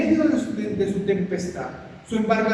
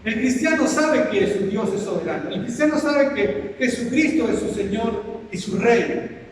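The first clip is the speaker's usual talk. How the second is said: loud, declaiming sermon voice; the preacher booms out God's sovereignty; preaching; 3 semitones higher, 4 dB louder than usual. Real, not acted. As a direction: proclaim it to the congregation. Preaching at full force.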